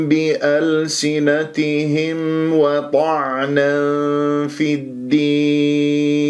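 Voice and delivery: slow, melodic recitation of the verse; measured tajweed recitation; Quran recitation. Shown as a demonstration. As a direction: chant slowly, stretching the long vowels.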